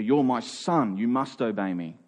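ordinary talk

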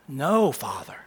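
whispered, reverent; a soft prayerful whisper. Retold or imitated. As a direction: breathe the words to the Father. Quoting Jesus' prayer.

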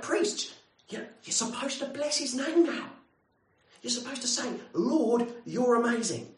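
high, urgent prompting; nudging the priest. Retold or imitated. Pilgrims prompting a tired priest, high and urgent. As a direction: egg the priest on.

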